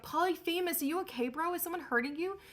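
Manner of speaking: worried neighbors calling in; concerned neighbors. Acted. Neighbors call through the door.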